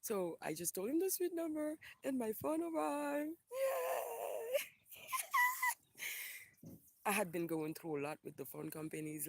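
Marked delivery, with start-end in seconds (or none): 0.9-4.6 high sing-out rising into a squeal; gleeful excitement. Real, not acted. Celebrating her new phone's arrival. 5.0-5.7 very high gleeful squealing laugh; squeal-laugh with sheer joy; delighted squeal. Real, not acted. Delight at new phone.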